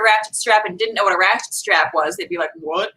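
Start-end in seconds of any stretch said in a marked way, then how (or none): 2.5-2.9 soft, higher-pitched puzzled question; mock bafflement. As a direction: act out a confused listener. Imagined listeners baffled by the name.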